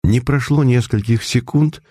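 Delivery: plain reading voice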